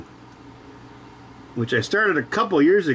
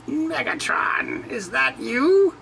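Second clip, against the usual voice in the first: high, slow screechy robot voice; Starscream impression; 12 semitones higher, slower than usual. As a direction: whine it up high like a traitorous robot. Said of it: "Coronation Starscream?".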